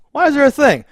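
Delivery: high, loud, baffled question; mock baffled character. Mocking a baffled character's question, high and loud. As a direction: squeak it out in disbelief.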